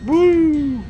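high loud whoop; excited whoop. Real, not acted. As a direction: cheer as you smash it. Head-bashing the elevator.

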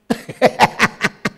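breathy staccato laugh; wheezy chuckle at his answer. Real, not acted. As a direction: laugh in short, quick puffs. Laughs at man having no plan.

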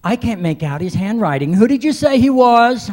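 high, loud imitation of a young girl; puzzled preteen girl. One preteen fan asks the other about the autograph, mock-puzzled. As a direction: build to a very high, loud baffled question. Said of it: "I heard one say to the other one".

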